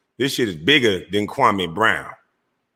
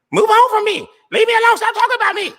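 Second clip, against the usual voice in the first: much higher, louder whiny put-on voice; mocking imitation; 4 dB louder than usual. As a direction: parody his plea to be left alone. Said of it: "Ain't that what you always tell people".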